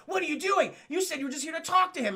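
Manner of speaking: high-pitched mock outrage; indignant protest. Brooklyn confronting Demona over the spell, indignant. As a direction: protest it in high, hurried disbelief.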